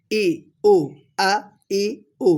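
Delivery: ই, উ, আ each a short glottal pop; sharp hamza. Shown as a demonstration. Teacher practising hamza, sharp popping আ, ই, উ. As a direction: cut আ, ই, উ off crisply from the throat. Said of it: "টাস টাস আওয়াজ হবে".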